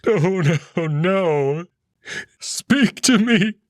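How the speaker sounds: high, loud, slow desperate pleading; Amicus, begging. Acted. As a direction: cry out to him as he slips away. Amicus begs Marco to speak.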